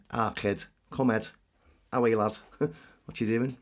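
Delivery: guttural Scouse greeting; Liverpool accent. Shown as a demonstration. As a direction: demo Scouse, scraping the back of the throat. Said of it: "it's kinda like that".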